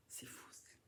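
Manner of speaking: breathless whisper under effort. Real, not acted. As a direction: whisper it while straining. Struggling to open the mask.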